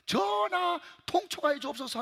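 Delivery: high, drawn-out plea of a minister to the king; pleading court official. Retold or imitated. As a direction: beg the king like a period-drama courtier. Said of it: "고관 대작이 뭐가". (왕에게 통촉을 간청하는 사극 대신 말투)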